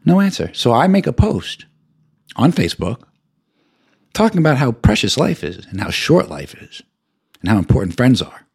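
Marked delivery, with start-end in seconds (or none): none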